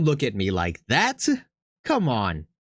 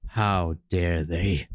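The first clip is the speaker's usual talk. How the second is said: low, slow indignant growl of anger; mock outrage; 6 semitones lower, much slower than usual. Reading a grim choice, playing up mock anger. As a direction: drag out the outrage. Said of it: "so much anger".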